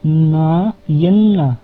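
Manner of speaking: यन्ना said slowly, ye glide at the start; teacher voicing यन्ना slowly; Tamil word demo. Shown as a demonstration. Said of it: "ये".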